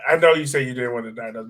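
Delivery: usual speaking voice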